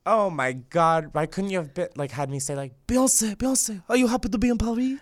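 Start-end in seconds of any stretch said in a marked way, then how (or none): 2.9-5.0 high-pitched put-on French accent; hammed-up French accent. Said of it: "In a French accent".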